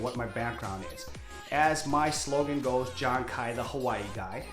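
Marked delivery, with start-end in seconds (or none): none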